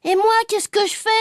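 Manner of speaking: put-on high, aggrieved little-boy question; protesting child. A narrator's reading. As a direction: pipe up high, feeling left out. Forgotten child demands a role.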